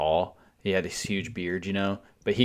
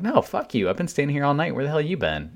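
high-pitched, protesting comeback; retold indignant outburst; 7 semitones higher than usual. Concertgoer defending his spot from a latecomer, indignant. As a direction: argue back, pitch jumping up. Retold argument with pissed-off woman.

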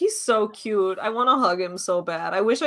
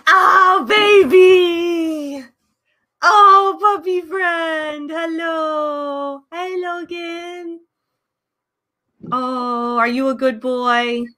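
talking to a dog; 5 semitones higher, 4 dB louder, much slower than usual. Real, not acted. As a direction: coo at a puppy. Dog comes on camera.

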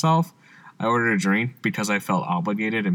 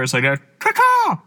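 chirping bird person; 11 semitones higher, slower than usual. Retold or imitated. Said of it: "'cause it sounds like a bird".

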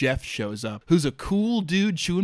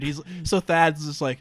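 plain reading voice

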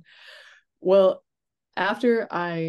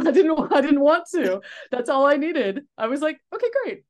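higher and louder, laughing through it; laughing delight; 10 semitones higher, 7 dB louder than usual. Real, not acted. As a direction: bubble over with a happy laugh. Laughing realization retold.

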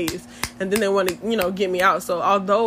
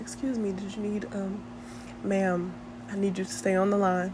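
smooth dispatcher voice; 9 dB quieter than usual. Pretend. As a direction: soothe the caller in a smooth hush. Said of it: "hit you with a smooth voice".

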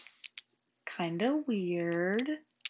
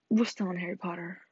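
ordinary talk, nothing marked

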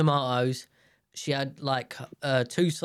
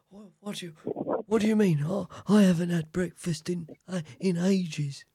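higher-pitched mock old-man grumble; put-on old-man voice, protesting on waking; 3 semitones higher than usual. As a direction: imitate a just-woken old man complaining. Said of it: "Like an old man".

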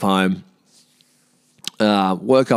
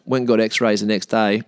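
ordinary talk, nothing marked

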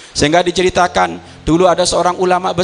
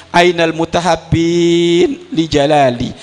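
solemn call; slower than usual. Retold or imitated. Voicing Allah's call.

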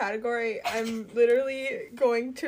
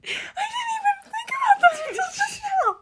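outraged shout; 5 dB louder, slower than usual. Real, not acted. Sudden realisation of another snub.

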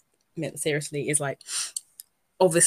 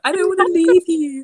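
higher, louder mock plea; pleading cry; 13 semitones higher, 8 dB louder than usual. Friend retells the lovers' farewell in a mock loud plea. Acting out a film character's plea.

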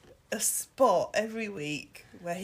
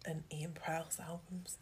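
whisper; 7 dB quieter than usual. Real, not acted. Joking aside about own albums.

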